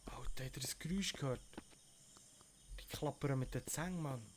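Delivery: tense hushed whisper. Real, not acted. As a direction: whisper it in alarm. Hearing a noise in game.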